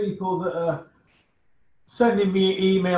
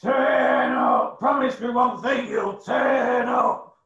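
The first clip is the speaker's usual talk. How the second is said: loud, high hammed-up callout; catchphrase shout; 7 semitones higher, 4 dB louder, slower than usual. Host echoing the fighter's challenge, loud mock shout. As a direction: bellow it like a fight promoter's plea.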